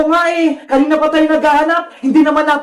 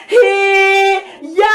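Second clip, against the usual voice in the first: long, higher martial-arts yell; battle cry; 4 semitones higher than usual. Acted. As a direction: draw it out as you strike. Battle cry while striking villain. (mahabang sigaw ng pag-atake)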